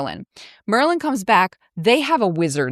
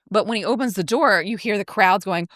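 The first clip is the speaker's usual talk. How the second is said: ordinary talk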